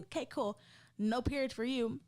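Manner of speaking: usual speaking voice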